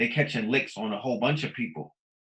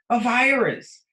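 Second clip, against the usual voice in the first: high and louder, hammering the answer; excited punchline; 9 semitones higher, 6 dB louder, slower than usual. Real, not acted. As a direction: land the answer up high and hard. Answering own rhetorical question, peak.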